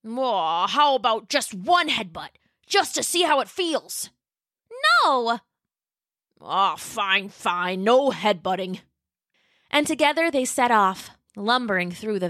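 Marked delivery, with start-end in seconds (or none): 0.1-8.8 lightly voiced sulky dinosaur; a mild grumbling give-in; Pat, sulking. Pat sulks.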